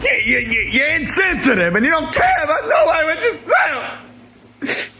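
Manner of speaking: high shouted hurt rant; mock furious. Brother screaming at Andrew for turkey noises, hammed-up fury. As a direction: yell like a betrayed turkey lover.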